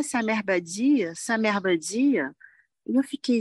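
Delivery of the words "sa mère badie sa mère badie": "sa mère badie" said slowly, as French speakers read "summer body"; French-style mispronunciation. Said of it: "eles leem a palavra em inglês e falam em francês".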